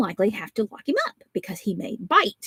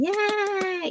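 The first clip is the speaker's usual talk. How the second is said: put-on cheering the baby; 12 semitones higher than usual. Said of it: "And I'm going".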